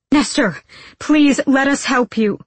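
high, loud pleading; desperate appeal. A narrator's reading. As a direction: call out and beg to help. Nina pleads with fighting friend.

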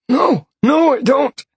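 high, pleading, frightened man; terrified captive. Acted. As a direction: swear it, panicking and in pain. Captive mage denying.

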